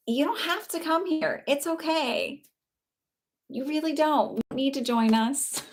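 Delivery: high, lilting send-off; dismissive sweetness. Real, not acted. Host waves off an unwelcome viewer, dismissive sweetness.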